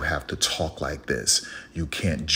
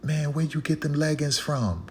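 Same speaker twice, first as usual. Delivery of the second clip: imitating lady; 9 semitones higher, 4 dB quieter than usual. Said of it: "the one lady the other day was like".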